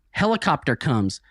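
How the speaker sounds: usual speaking voice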